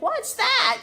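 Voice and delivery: high, loud startled question. Retold or imitated. Girlfriend hearing the tractor, high and startled. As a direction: girlfriend jolted out of her calm.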